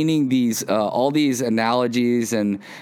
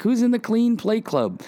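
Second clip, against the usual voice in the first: high, sing-out parent question; rallying parent; 11 semitones higher than usual. Retold or imitated. Parent calling the kids to clean their plates, high and rallying.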